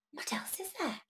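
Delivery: soft secret whisper. Acted. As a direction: hush it like a secret. Whispering to children.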